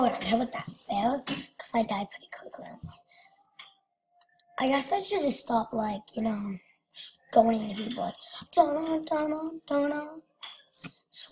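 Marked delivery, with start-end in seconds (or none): 1.2-2.7 sheepish whispered aside. Real, not acted. 8.5-10.2 suspense tune. Retold or imitated. Imitating suspense music.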